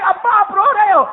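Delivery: put-on high, startled little boy's voice; a child shocked to see his father cry; surprised child. Son sees father crying.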